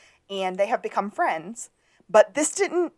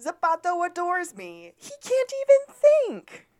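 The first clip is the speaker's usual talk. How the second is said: very high, haughty put-on voice; acted insult; 11 semitones higher, 4 dB louder than usual. Podcaster acting the judge's insult number twenty, very high. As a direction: push it way up, louder toward the end. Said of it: "Number twenty was".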